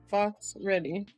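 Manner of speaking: ordinary delivery, nothing marked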